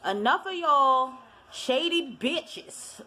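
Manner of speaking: slow, high, hammed-up diva snap at the women; exaggerated sassy version. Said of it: "But she didn't say it like that".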